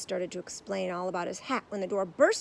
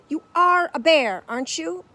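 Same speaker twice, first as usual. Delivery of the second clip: actor voice; 8 semitones higher, 14 dB louder than usual. A narrator's reading.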